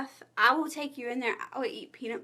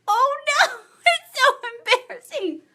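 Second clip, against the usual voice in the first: high, loud laugh-crying through words; embarrassed laughing; 13 semitones higher, 10 dB louder, faster than usual. Real, not acted. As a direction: laugh helplessly, nearly crying. Embarrassed laughing fit on camera.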